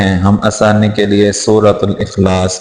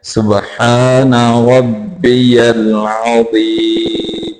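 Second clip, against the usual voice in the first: slow, drawn-out tajweed recitation; measured model recital for learners; Quranic recitation; 2 semitones higher, much slower than usual. Shown as a demonstration. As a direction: recite the verse slowly, holding the long vowels.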